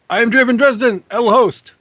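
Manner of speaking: a light, hammy Spanish lilt on the host intro; mock Spanish accent. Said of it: "Why am I talking, like, partially Spanish?".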